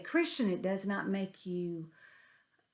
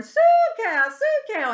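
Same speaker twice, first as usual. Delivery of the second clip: far higher, much louder holler across the field; 13 semitones higher, 11 dB louder, slower than usual. Retold or imitated. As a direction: call the cows from a distant hill. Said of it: "start hollering".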